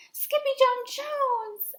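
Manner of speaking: high, loud, drawn-out call of his name; the family calling out the kitty's name, high and loud; crying out. Acted. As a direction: shout the name in shock. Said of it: "everyone cried".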